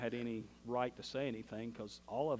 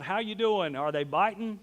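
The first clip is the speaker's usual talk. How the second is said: loud call from the shore, higher; yelling out; 6 semitones higher, 8 dB louder than usual. Retold or imitated. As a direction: shout across the water to the boat. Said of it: "the man yells out".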